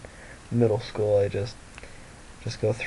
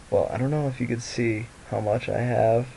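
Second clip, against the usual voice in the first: ordinary talk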